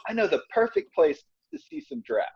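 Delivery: lightly put-on Ugandan accent, high; a hint of a Ugandan guide; Ugandan tour guide impression. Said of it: "my terrible impression of a Ugandan tour guide".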